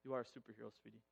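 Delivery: low, soft aside; tender praise. Real, not acted. Husband praising his wife, soft and tender. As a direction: drop right down and say it tenderly.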